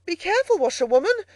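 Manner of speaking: loud, high-pitched caution; urgent shouted warning; warning call. A narrator's reading. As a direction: call out in alarm to the driver. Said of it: "call out warningly".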